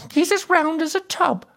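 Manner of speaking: high, scornful jibe in a princess's voice; mocking princess. A narrator's reading. Princess jeering at a too-fat suitor, mocking.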